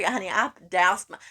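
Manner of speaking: normal talking voice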